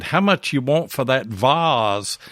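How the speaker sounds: put-on slow northern Yankee accent, "vase" said as "vahz"; a Yankee visitor asks a Southern seller the price, "vase" as "vahz". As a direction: imitate a Yankee asking a price, "vase" rhyming with "Oz". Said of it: "with that Yankee accent".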